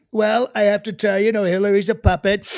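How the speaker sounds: loud impression with sniffs; booming, sniffy debate impression; Trump. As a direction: sniff loudly between emphatic phrases. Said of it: "the sniffs became part".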